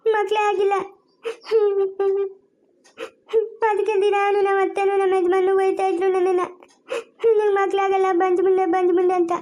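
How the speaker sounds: tearful account broken by sobs; crying. Acted. Wronged daughter tells Krishnanna of her married life, crying. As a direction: weep while telling of your suffering. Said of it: "ಅಳ್ಬೇಡಮ್ಮ Rukminamma".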